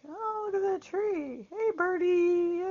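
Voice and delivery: high cooing voice to a bird; baby talk. Retold or imitated. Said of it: "But I will talk, you know, like".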